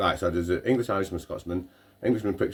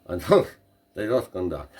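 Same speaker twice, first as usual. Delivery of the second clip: normal talking voice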